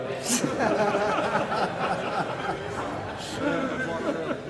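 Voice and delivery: short amused laugh; amused chuckle. Real, not acted. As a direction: chuckle at the joke. Treasurer laughs before answering.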